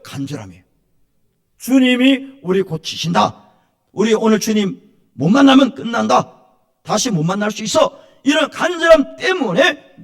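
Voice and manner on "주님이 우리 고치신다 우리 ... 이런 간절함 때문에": loud, high fervent preaching; preaching at full force. Acted. Pastor preaching loudly to his congregation. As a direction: proclaim it to the congregation.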